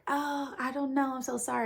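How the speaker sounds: high, breathy-free mock soap star apology; a hammed-up high soap opera wife; soap opera lady. Retelling the soap opera, the lady apologizes in a mock high voice.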